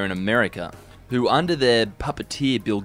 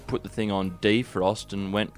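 ordinary talk

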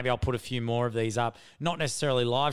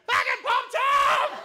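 high, slow alarmed yell; yelling the name in alarm; panicked shout; 5 dB louder, slower than usual. Retold or imitated. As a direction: cry out in sudden panic. Panic realising friend's danger.

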